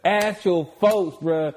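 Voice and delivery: high, loud boastful hype; hyped bragging. Real, not acted. Bragging to the host about his playing days, hyped and high. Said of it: "hyped boasting about hoop days".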